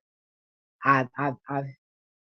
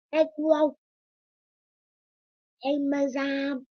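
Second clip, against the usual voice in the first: high pet call; 10 semitones higher, slower than usual. Real, not acted. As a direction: chirp at the dog to get its attention. Calling dog in high voice.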